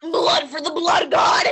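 loud, slow war shout; hammed-up warrior yell; battle cry. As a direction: roar it like a warlord.

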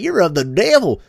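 high, loud mock scolding; Waterboy's mother. Mother warning her son in Waterboy, high mock-scolding caricature. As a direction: imitate a Southern mama denouncing sin. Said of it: "And his mother like".

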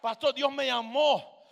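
high, loud, drawn-out preaching call; shouted exhortation. Real, not acted. Preacher urging the church not to fear, shouted exhortation. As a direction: cry it out slowly to the crowd.

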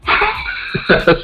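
soft unvoiced chuckle; breathy laugh. Real, not acted. Laughs about the ban.

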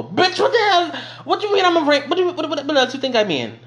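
high, loud incredulous rant; exasperated. Real, not acted. Exasperated at slow classmates.

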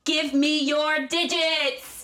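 very slow, high, drawn-out demand; Nicolas Cage nearly screaming. Acted. Host acts Cage demanding a number, nearly screaming. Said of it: "Cage nearly screamed".

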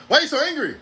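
high-pitched mock questioning; imitated accuser. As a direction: mimic someone needling you about your anger. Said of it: "a diatribe about why I'm so angry".

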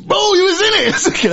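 excited greeting. Retold or imitated. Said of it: "when I saw him in the premiere".

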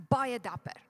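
"baie" leaned on, "dapper" lighter; weight on "baie" to mean very brave; stress on baie. Shown as a demonstration. Preacher corrects himself to the church with stress on baie. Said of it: "Not just dapper".

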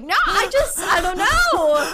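high, loud, slow laughing wail; laughing panicked. Retold or imitated. Stuck in front of two gym staff, laughing wail. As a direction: dissolve into mortified laughter. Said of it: "I'm just like".

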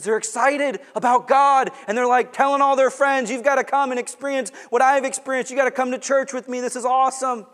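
loud, high, quick excited voicing of new believers; excited preaching. Preacher voicing new believers inviting friends: loud excited. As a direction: sell it like a thrilled new convert.

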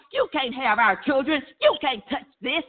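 usual speaking voice

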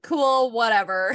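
breezy, laughing brush-off; dismissive older sister. Retold or imitated. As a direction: shrug it off like a big sister. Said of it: "older sister energy where I'd be like".

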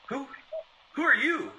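high-pitched, halting question; startled demand. A narrator's reading. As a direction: demand it, pitch leaping on the repeat. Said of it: "demanded Devereaux".

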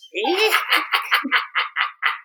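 high spooky cackle; evil cackle. Acted. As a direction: cackle like a jack o lantern. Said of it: "that was really good".

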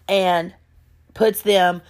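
normal talking voice

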